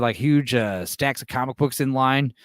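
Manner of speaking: ordinary talk, nothing marked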